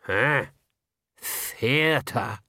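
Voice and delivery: mildly dark, scornful echo; a touch of dark suspicion; dark disdain. A narrator's reading. Granny doubting Nanny's talk of theatre, mildly dark. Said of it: "said Granny darkly".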